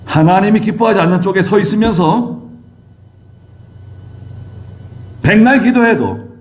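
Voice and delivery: high, forceful pulpit delivery; emphatic high-pitched sermon proclamation; preaching. Real, not acted. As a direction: preach it high and hard, drawing out key phrases.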